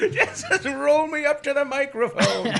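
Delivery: put-on high, slow old-man whine; frail old man. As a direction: wheel me out, feeble. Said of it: "Give me a wheelchair".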